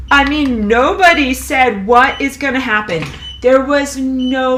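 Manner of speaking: mock the authorities while furious; real anger. Real, not acted. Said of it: "I'm very mad".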